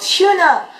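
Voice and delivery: high, loud shout from afar; imitated yell. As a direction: yell the name like a fan at the back. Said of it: "they yelled".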